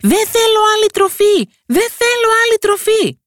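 very high, loud little voice from inside the cow; tiny boy shouting. A narrator's reading. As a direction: shout it twice as a tiny stubborn child. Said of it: "φώναξε ξανά".

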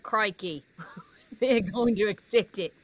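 playful attempt at a New Zealand accent; lightly put-on Kiwi accent, with laughter. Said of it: "I should be able to do a New Zealand accent".

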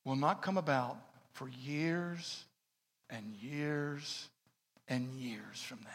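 slow, soft, dropping into a whisper; soft-spoken, fading to whisper. Retold or imitated. Pastor warning him of a long wait, softly fading to a whisper. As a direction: let it fade to a whisper. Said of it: "Very soft-spoken woman".

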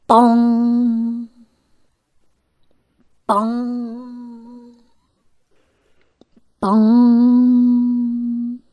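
long, ringing imitated bong; clock chime imitation. A narrator's reading. Storyteller sounds the palace clock striking, drawn-out bong. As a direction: toll it like a great clock bell.

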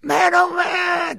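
high, slow, drawn-out shout of wonder; hammed-up radio host amazement; exaggerated exclamation. Real, not acted. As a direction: stretch it out like a showman marveling. Host's exaggerated on-air exclamation.

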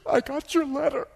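mock-tearful, high-pitched phone voice; high, weepy retelling. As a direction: imitate a woman choked up on the phone, high and loud. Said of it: "She's crying ... can't even talk".

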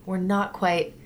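ordinary delivery

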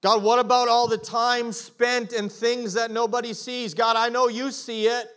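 pleading prayer. Retold or imitated. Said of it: "it's like".

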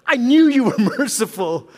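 high, half-laughing grievance; mock outrage at God's mercy; laughing accusation. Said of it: "bothered by it".

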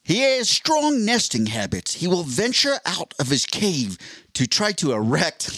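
slow, measured Australian nature narration; Steve Irwin. Acted. Wildlife host explaining a man's nesting, slow Australian impression. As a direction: narrate nesting habits slowly with an Australian accent. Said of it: "I don't think I can do the Australian accent".

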